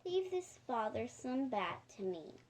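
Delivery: usual speaking voice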